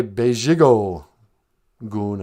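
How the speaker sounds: "Bejigo Goon" said slowly; careful teaching "Bejigo Goon"; Ojibwe word. Shown as a demonstration. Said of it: "You could also say".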